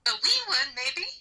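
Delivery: Irish attempt that sounds a bit Scottish; attempted Irish accent. Co-host answering a request for an Irish accent, slightly Scottish. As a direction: try Irish, drifting toward Scottish. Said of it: "Can you do an Irish accent, [name]".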